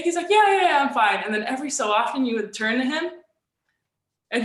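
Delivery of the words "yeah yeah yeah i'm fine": high, loud rush of yeahs; mock hasty reassurance. Coach telling his worried players he's fine, in a loud, hurried voice. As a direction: brush off the players' worry loudly.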